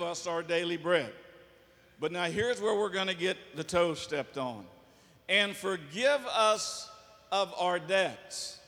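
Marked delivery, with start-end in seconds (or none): 5.3-8.6 slow prayer recitation. Shown as a demonstration.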